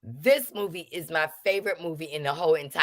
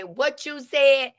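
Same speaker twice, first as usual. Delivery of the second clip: drawn-out high "What you said?"; slow sassy YouTube catchphrase; sassy vlogger voice; YouTube diction; 8 semitones higher, 3 dB louder, slower than usual. Retold or imitated. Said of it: "using my YouTube, you know, diction".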